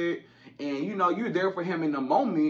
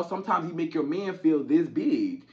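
ordinary talk

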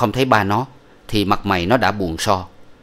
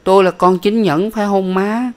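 put-on much higher little child asking his mom; small boy's voice; 11 semitones higher, 3 dB louder than usual. A narrator's reading. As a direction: pipe it up like a small boy. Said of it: "Thằng nhỏ lại trả lời rằng".

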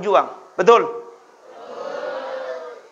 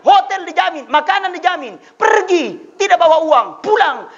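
raised, driving sermon voice; fiery preaching; 8 semitones higher than usual. Acted. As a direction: hammer the point home to the crowd. Preaching at full force.

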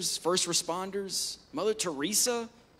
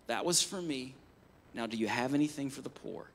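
softer, lower, calmly turning the other cheek; meek and tender, unbothered; gentle shepherd reply; 6 semitones lower, 6 dB quieter than usual. Retold or imitated. Mother Teresa answers the spitting shop owner gently, soft and low. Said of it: "she responded to him like a shepherd".

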